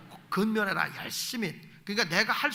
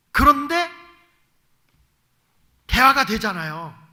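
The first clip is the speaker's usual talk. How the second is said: emphatic preaching; 5 semitones higher, 11 dB louder than usual. Real, not acted. Preacher stressing point.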